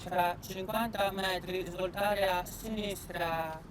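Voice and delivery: slow, flat navigator voice; satnav voice. Acted. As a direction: read directions like a GPS. Imitates satnav directions.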